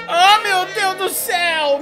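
slow loud high wail; anguished cry. Real, not acted. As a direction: wail it out in despair. Just soiled himself in game.

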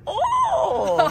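high shouted "Oh"; Rob Schneider impression. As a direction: squeal it like the movie guy. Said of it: "like that guy on the Adam Sandler movies".

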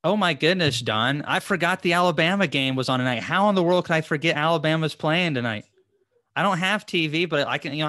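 real loud exclamation of alarm; talking too loud. Real, not acted. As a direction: blurt it out loudly, just realizing you forgot. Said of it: "No wonder I'm talking so loud".

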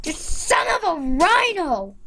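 usual speaking voice

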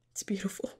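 soft whisper of admiration; whispered It's beautiful. Real, not acted. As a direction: breathe it out in awe. Admiring terraces.

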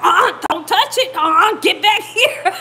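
loud, high mock warning to dogs; hammed-up husband shouting commands; imitated yelling. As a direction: yell it, close to cracking up. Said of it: "He was yelling at him too".

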